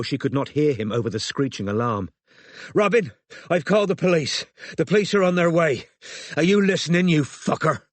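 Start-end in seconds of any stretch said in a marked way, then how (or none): 2.7-7.8 raised, high, furious threat; yelling and swearing. A narrator's reading. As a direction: yell threats down the phone at an attacker. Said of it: "yelling and swearing into his phone".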